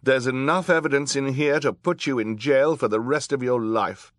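high, loud, indignant woman judge; defiant judge. A narrator's reading. Judge refusing to help the villain Olaf, high loud defiance.

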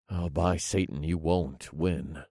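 quiet, dismissive chuckle, then slow words; chuckling. A narrator's reading. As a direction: chuckle softly and brush off the threat. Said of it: "I chuckled as I shook my head".

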